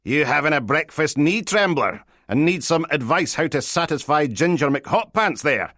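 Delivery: high, leering phone banter; loud and sleazy. A narrator's reading. Detective teases sergeant about his bedmate, loud sleazy leer. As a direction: tease loudly with sleazy relish. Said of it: "came through loud and sleazy".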